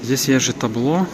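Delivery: ordinary delivery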